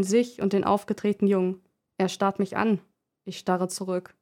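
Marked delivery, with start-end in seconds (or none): none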